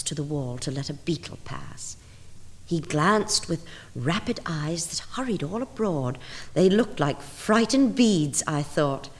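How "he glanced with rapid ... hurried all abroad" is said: ordinary delivery, nothing marked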